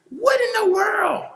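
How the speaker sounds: high, drawn-out what in the world; stunned cry at the screen; shocked outcry. Real, not acted. Watching a viral video, shocked outcry.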